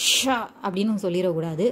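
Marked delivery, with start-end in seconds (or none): 0.1-0.4 ஷ pushed hard as a heavy sha; over-pressed ஷ. Shown as a demonstration. Teacher shows what to avoid, ஷ pressed too hard. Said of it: "ரொம்ப அழுத்தியும் சொல்லிடக்கூடாது".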